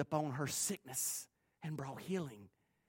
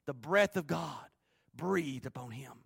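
ordinary delivery

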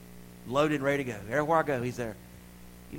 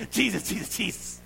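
higher, quick-fire name repeats; rapid repetition; 6 semitones higher than usual. Shown as a demonstration. Preacher showing the devil a fast Jesus, rapid repeats. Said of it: "Let me say it fast real time".

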